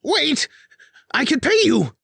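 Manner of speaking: high, loud frantic begging; panicked plea. A narrator's reading. Spy master begs Elise, panicked high plea. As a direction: beg for your life.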